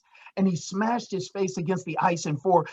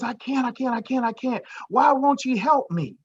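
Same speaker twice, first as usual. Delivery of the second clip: frightened pleading; 8 semitones higher than usual. Acted. Climber refuses, pleading.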